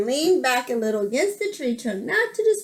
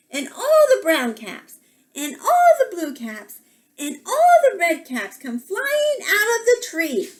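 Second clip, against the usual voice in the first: lilting picture-book narration; children's story reading; 5 semitones higher, 4 dB louder than usual. A narrator's reading. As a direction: tell it to small children, lingering on the details.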